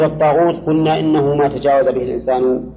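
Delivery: ordinary talk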